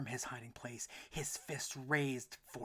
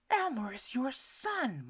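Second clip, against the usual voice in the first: high, slow pleading mama; arguing mother; 13 semitones higher, 10 dB louder, slower than usual. A narrator's reading. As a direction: defend her son. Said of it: "Mama pleaded".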